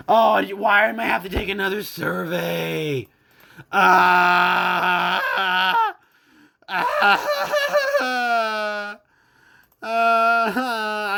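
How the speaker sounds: high, loud mock wail over another survey; hammed-up fake sobbing cries; mock crying. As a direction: melodramatic pretend crying. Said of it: "I'm crying".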